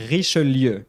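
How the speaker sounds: "Richelieu" as "ree-shuh-LYUH", middle e sounded; French pronunciation demo. Shown as a demonstration. Teacher spelling out the middle vowel, French "Richelieu". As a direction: pronounce all three syllables of "Richelieu". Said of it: "You would say the E in the middle".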